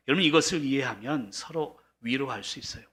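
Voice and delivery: usual speaking voice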